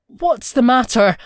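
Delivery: stuttering, alarmed. A narrator's reading. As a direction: ask in alarm, a bit flustered. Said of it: "He stuttered".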